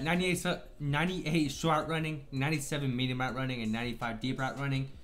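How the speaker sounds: ordinary talk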